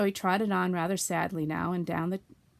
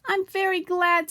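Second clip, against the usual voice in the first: high, loud and slow with delight; happy; 10 semitones higher, 8 dB louder than usual. A narrator's reading. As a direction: beam it out, pleased with yourself. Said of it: "said Pooh happily".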